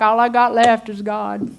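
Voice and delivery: lightly mock the tired believer's self-pity; preacher mocking a despairing believer, mild whine; mock whiny complainer. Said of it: "God is there like".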